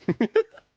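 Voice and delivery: a high, loud laughing burst; sudden loud laugh; loud burst of laughter. Real, not acted. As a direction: crack up at your own line. Laughing at his own excuse.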